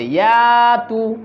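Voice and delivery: ইয়াতু with the ইয়া vowel drawn out long; slow stretched ইয়াতু. Shown as a demonstration. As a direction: hold the ইয়া before তু. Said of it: "এর মধ্যে টান আছে".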